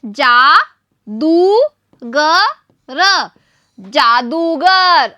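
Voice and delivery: drawn out slowly, syllable by syllable; slow sounding out. Shown as a demonstration. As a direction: stretch the word जादूगर slowly for the children. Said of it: "ये क्या बना है?". (धीरे-धीरे खींचकर पढ़ना)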